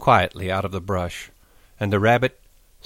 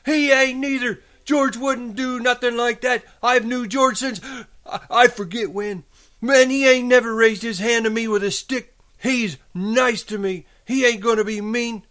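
high-pitched, stubborn retort; belligerent; 4 dB louder than usual. A narrator's reading. Lenny defending George to the rabbit, belligerent high voice. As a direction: argue back hotly in a high voice. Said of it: "Lenny retorted belligerently".

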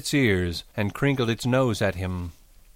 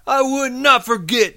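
high, loud denial; loud protest; 7 dB louder than usual. A narrator's reading. Lenny contradicting the rabbit, loud high protest. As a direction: insist at the top of the voice. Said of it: "Lenny said loudly".

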